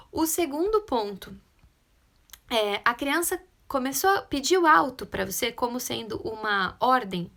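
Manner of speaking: usual speaking voice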